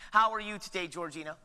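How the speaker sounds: ordinary talk, nothing marked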